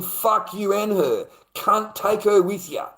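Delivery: slow, high, loud angry dismissal; furious. Retold or imitated. Man sending girlfriend off with the intruder, furious. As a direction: spit it out in full-on rage, drawing it out. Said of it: "I was so fucking angry".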